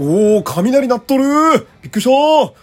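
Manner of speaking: loud, high yell of surprise; real startled shout. Real, not acted. Radio host startled by thunder mid-show, shouting. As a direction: jolt and cry out at sudden thunder.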